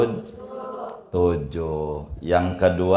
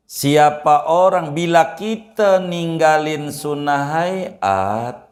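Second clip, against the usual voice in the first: high, slow, raised preaching voice; slow high sermon delivery; emphatic preaching; 8 semitones higher, 3 dB louder, slower than usual. Acted. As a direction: drive the rule home to the congregation, slowly. Preacher states the rule emphatically.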